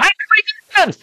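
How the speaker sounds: put-on very high, bouncing child's squeal; excited little girl. Little girl jumping at the gate, asking how to get in, excited squeal. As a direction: jump with eager glee.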